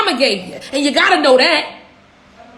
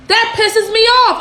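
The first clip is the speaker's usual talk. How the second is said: irritated; 6 semitones higher than usual. Real, not acted. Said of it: "I'm irritated right now".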